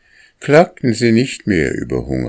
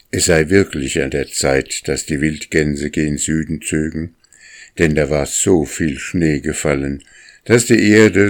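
ordinary delivery, nothing marked

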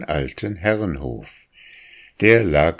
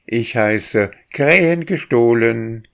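higher, louder cheeky yell; yelling back; 5 semitones higher, 5 dB louder than usual. A narrator's reading. Boy yells his nickname to the drake, loud and cheeky. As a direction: shout your name down with a grin. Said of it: "schrie der Junge".

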